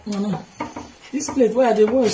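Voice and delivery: ordinary talk, nothing marked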